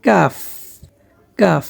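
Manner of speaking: কাফ with a front k as in Bangla কাক; কাফ as "kaff"; ordinary K. Shown as a demonstration. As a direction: demonstrate কাফ, holding the final f. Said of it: "ঠিক তেমনিভাবে উচ্চারণটি হবে".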